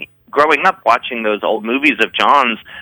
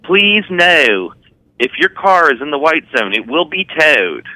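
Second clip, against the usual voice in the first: put-on higher-pitched airport announcement in a Baltimore accent; Baltimore announcer; 5 semitones higher than usual. Mimicking the woman on the airport loudspeaker, Baltimore accent. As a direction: imitate a Maryland loudspeaker voice. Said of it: "the loudspeaker's like".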